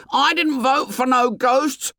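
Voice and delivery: high shrill protest; shrill Piggy. A narrator's reading. Piggy snatches the conch, shrill and high. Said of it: "Piggy's voice shrilled".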